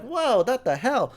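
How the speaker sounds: mock shock. Reacting to mom coughing blood.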